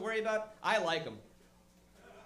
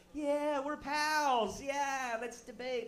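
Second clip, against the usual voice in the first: slow, drawn-out fake friendly; mock buddy Obama; 5 semitones higher, much slower than usual. Obama faking friendship with Mitt on stage, slow fake chumminess.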